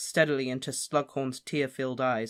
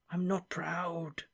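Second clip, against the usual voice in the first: slow, much softer confession; whispered shame; 4 semitones higher, 6 dB quieter, much slower than usual. Acted. Slughorn whispering his shame to Harry. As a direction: murmur it through your fingers. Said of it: "he whispered through his fingers".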